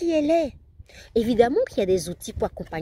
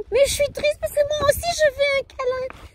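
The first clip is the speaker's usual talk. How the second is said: put-on much higher whiny boy wanting a hug; voicing her other son, pitched up and tearful; sad little boy voice. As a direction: whimper it like a jealous small boy. Second son voiced crying.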